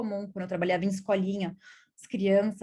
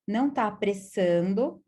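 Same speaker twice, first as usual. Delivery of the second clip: normal talking voice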